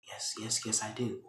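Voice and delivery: tiny, very soft, repeated yes; cowed murmur. Retold or imitated. Veronica answering Catherine's threat, cowed and hushed. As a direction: give in quickly under your breath.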